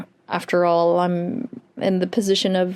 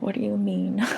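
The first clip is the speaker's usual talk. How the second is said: soft, stunned whisper of disbelief; hushed disbelief; 5 dB quieter than usual. Retold or imitated. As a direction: breathe it out in shock. Stunned by revelation.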